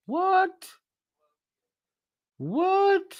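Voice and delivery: very high squeaky double take; shocked what. Real, not acted. Streamer reacting to a viewer's teasing comment, shrill surprise. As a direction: yelp it in disbelief.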